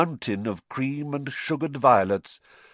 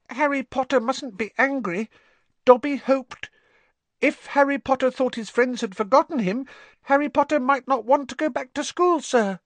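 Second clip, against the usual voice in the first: high, pleading elf voice, louder; high anxious house-elf; 3 dB louder than usual. A narrator's reading.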